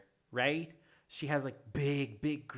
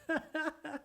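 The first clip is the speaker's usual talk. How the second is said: high giggle; 12 semitones higher than usual. Real, not acted. After the squeal.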